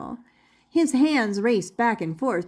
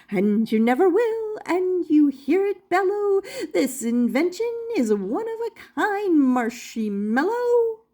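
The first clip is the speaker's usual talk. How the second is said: lightly voiced jolly inventor; 8 semitones higher than usual. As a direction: greet the guests like a cheery showman. Doctor Marvel's rhyming voice.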